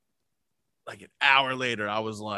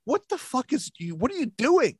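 high-pitched bewildered question; high, puzzled impersonation of a partner; imitating baffled wife; 10 semitones higher than usual. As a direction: ask it in a mock wife voice, high and incredulous. Voices bewildered wife catching him.

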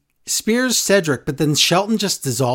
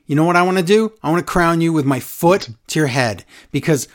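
ordinary talk, nothing marked

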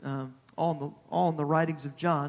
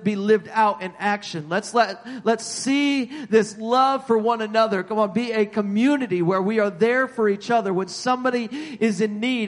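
raised pitch, punching the key words; fervent pulpit appeal; impassioned preaching; 5 semitones higher, 6 dB louder than usual. Real, not acted. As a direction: exhort the congregation with force. Preaching at full force.

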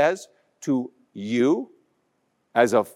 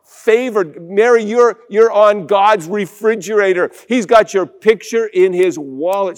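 louder, higher, eager reassurance; insistent angel; 4 semitones higher, 4 dB louder than usual. Acted. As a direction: convince her warmly but firmly. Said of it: "he has to, like, repeat".

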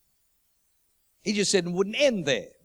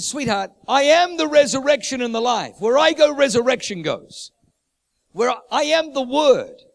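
louder and higher, proclaiming; proclaiming Jesus; 4 semitones higher, 7 dB louder than usual. Acted. Jesus answering Martha, a loud bold proclamation. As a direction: ring out the declaration. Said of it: "He said".

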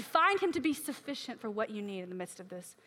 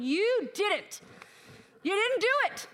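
higher-pitched exasperated protest; acted-out complaint, hitting the key words hard; disappointed accusation; 7 semitones higher, slower than usual. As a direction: plead your case, aggrieved and insistent. Acts out confronting God in disappointment.

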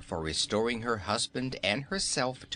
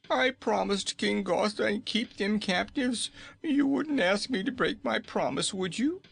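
high, strained gnome king voice; pleading Nome King; 11 semitones higher than usual. A narrator's reading. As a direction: beg like a desperate little king.

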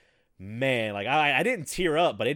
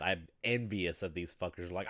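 ordinary talk

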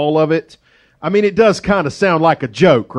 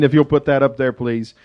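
usual speaking voice

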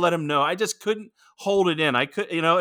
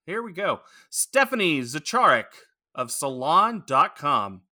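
ordinary talk, nothing marked

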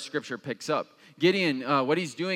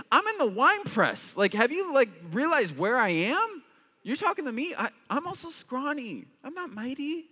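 much higher, squeaky mock protest; incredulous protest; 10 semitones higher than usual. Pastor answering the angel, squeaky disbelieving protest. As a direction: play up the scrawny guy who can't believe it. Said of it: "I first would be like".

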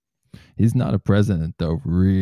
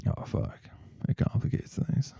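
whisper; 6 semitones higher, 9 dB quieter than usual. Real, not acted. Mock dismay at Team Jacob.

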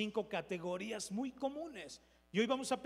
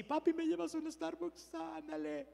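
put-on high-pitched, drawn-out kid's begging; pleading child impression; 9 semitones higher, slower than usual. Preacher mimics his kids asking Dad for Starbucks, high pleading child voice. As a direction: imitate children wheedling their dad. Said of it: "Bueno, mis hijos sí".

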